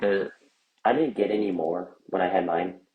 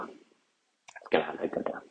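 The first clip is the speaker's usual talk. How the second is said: whisper; 3 semitones lower, 6 dB quieter, slower than usual. Acted. Ominous whispered aside.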